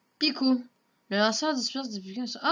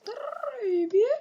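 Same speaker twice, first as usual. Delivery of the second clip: squeaky Pokémon cry, much higher and louder; Pokémon imitation; 14 semitones higher, 7 dB louder than usual. Gamer imitating a Pokémon for his viewers, high squeak. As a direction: shriek it like a little Pokémon. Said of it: "j'imite très bien les Pokémon".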